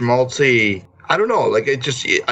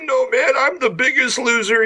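whiny mock voice; 11 semitones higher than usual. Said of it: "It's like".

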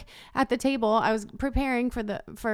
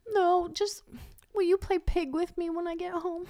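put-on higher, hopeful little boy voice; voicing little son; 7 semitones higher, 3 dB quieter than usual. Mom imitating her son asking to play Pig, higher voice. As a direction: ask sweetly like a small kid.

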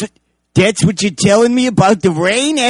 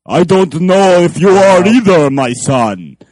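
slow, louder hammed-up vampire father; mock Dracula father; 7 dB louder, slower than usual. Actor playing the dad in a hammy Dracula voice. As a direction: play the dad like a count. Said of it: "What is his voice?".

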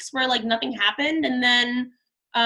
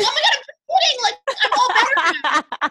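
much higher, louder, laughing take-back; hammed-up panicked retraction; frantic backpedaling; 5 dB louder, faster than usual. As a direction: scramble to undo the fib, laughing. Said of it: "I was like".

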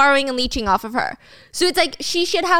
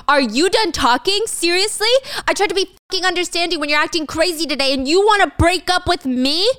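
raised, higher-pitched outraged tirade; acted angry yelling; 6 semitones higher than usual. A narrator's reading. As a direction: berate her in heated disbelief. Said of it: "is screaming in the alleyway".

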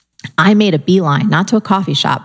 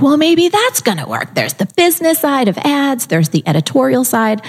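much higher, lit-up voice; excited idea; 8 semitones higher than usual. Retold or imitated. Career-changer discovering magazine publishing, excited and high. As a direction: sound like the answer just hit you. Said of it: "I was like".

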